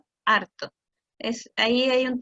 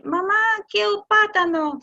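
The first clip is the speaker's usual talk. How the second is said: put-on child asking; 6 semitones higher, 4 dB louder than usual. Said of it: "El niño dice".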